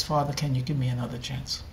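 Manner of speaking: humble plea. Acted. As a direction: ask it small and humbled. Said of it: "in a voice that his father had never heard him say before".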